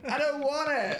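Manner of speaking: very high mock squeal. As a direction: squeal it out in disgust.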